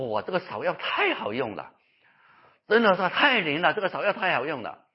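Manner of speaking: mock-amazed, emphatic endorsement; gushing praise. Preacher retells a patient praising a herbal remedy, gushing. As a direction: imitate an amazed convert raving. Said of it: "一直在夸草药好用".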